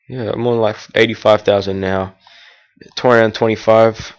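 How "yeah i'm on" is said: usual speaking voice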